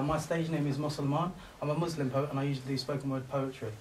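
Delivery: usual speaking voice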